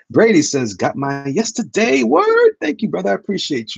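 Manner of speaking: sudden high, loud whoop of thanks; excited shout. Real, not acted. Streamer shouting thanks to chatter Brady, excited. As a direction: cheer the viewer's gift loudly.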